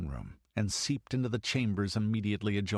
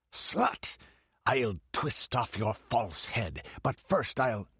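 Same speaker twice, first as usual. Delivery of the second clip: furious hiss. A narrator's reading. The priest throttles the girl, hissing with fury. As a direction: spit it like a cobra. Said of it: "his voice hissed like a cobra".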